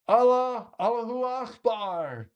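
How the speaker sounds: high, drawn-out crowd chant; imitated yell. Lone man yelling out in the stadium, imitated high yell. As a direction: call it out long and high. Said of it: "stands up and yells out".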